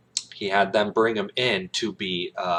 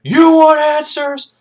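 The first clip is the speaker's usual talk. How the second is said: very high, loud, slow bellow; shouted taunt; 12 dB louder, slower than usual. Retold or imitated. Jessup roars the taunt back at Kaffee. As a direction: blow it up into a roar. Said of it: "He's like".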